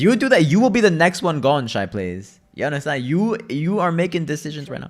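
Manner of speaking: loud mock-menacing threat. Real, not acted. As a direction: bark a warning at Shy Plays. Threatening a player in game.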